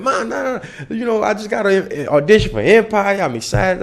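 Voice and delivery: high, bubbly rush of news; excited. Retold or imitated. Telling mom about the audition, thrilled and high. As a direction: burst with it, laughing. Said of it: "I'm all excited".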